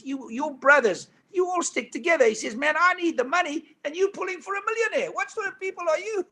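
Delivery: high-pitched mock outrage, rising to a cry; Rabbit's indignant protest.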